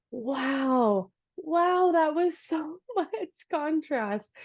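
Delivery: slow, wondering wow, rising high; acted-out astonished realization; amazed. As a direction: marvel at it, drawing the words out with a laugh. Acting out her realization after call.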